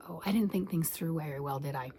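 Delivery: quiet, low admission; mock sheepish. Answering husband's question about two blankets, mock sheepish. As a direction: trail down softly, as if caught out.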